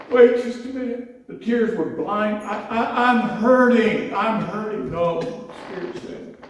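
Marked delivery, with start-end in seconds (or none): 0.1-1.3 high, louder cry of protest; retold protest, pitched high and louder. Arguing with the Spirit. 2.4-4.9 high, louder, slow anguished plea; retold anguished plea, slowed and raised; pained protest. Protesting to the Spirit.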